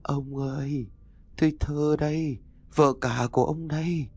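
high, eerie ghostly call; otherworldly echoing voice. A narrator's reading. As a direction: call to your husband as a possessing spirit. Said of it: "cất giọng âm vang thoát tục".

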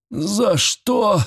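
high bleating whine; frightened man bleating from the coffin; bleating plea. A narrator's reading. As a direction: bleat it out pitifully. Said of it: "проблеял Звенигонь".

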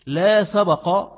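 loud, very slow "لا سبق" as la sa-baq; slow sabaq. Shown as a demonstration. Teacher quoting the hadith, slow and loud "لا سبق". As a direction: hit "لا سبق" slowly and loudly, b open. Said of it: "هي لا سبق مش لا سبق".

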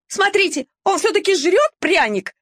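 high, outraged yap; indignant yapping. A narrator's reading. Vilka alerting the dogs the puppy is eating, high indignant yap. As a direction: yap it like an offended mongrel. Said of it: "возмутилась Вилька".

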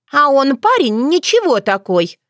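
put-on high, coy girlish voice; coquettish. A narrator's reading. Dunya praising the blacksmith's son, coquettishly. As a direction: say it coyly, pitched high. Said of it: "Дуня кокетливо хлопнула ресницами".

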